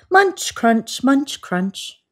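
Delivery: high, chewy munch crunch; munching sound words. A narrator's reading. Storyteller voicing a giraffe chewing leaves, high and playful. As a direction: chomp it out like a giraffe eating.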